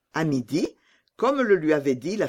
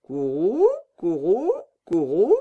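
three rolled coos sweeping upward; pigeon cooing; 4 dB louder than usual. Acted. Pigeon cooing after answering Gerda, three rising calls. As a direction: coo like a pigeon, rising each time.

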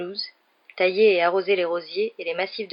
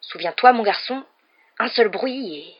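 louder, menacing threat, higher on mon garçon; furious warning; 4 semitones higher, 5 dB louder than usual. A narrator's reading. Uncle Vernon threatening Harry at the stairs, furious warning. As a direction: threaten him through the stairwell. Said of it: "Le visage furieux de l'oncle Vernon".

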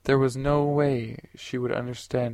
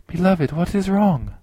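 higher and louder, anxious woman's question; a frightened woman's urgent question; scared; 7 semitones higher, 6 dB louder than usual. A narrator's reading. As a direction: ask in sudden worry for him. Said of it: "Michali looked very scared".

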